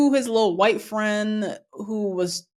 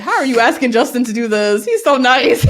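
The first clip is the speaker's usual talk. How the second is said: high, loud, laughing protest; exasperated disbelief tipping into laughter; incredulous protest; 5 semitones higher, 7 dB louder than usual. Retold or imitated. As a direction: plead his case in mock outrage. Retelling own incredulous reaction, laughing.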